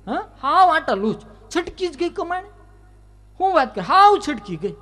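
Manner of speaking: high, loud village gossip voice; shrill-free but high neighbour spreading news; gossiping woman. Acted. Neighbour woman gossiping to Yashoda, high and loud.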